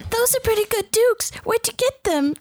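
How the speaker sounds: high cartoonish admirer; squeaky impressed onlooker; high put-on voice. Acting a spectator voice.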